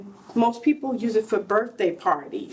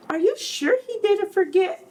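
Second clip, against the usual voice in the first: put-on higher, worried little boy voice; anxious child; 8 semitones higher than usual. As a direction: ask like a boy afraid he's forgotten. Worried child asks mother.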